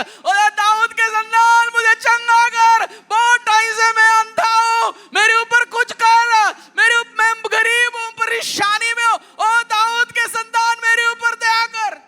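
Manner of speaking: loud, high, slow pleading shout; desperate shouting. Retold or imitated. Blind beggar shouts desperately to Jesus, loud high plea. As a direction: scream for mercy with everything you have. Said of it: "इसने सुना था".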